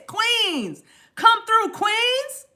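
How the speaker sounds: excited cheer. Real, not acted. Hyping the audience, exclaiming.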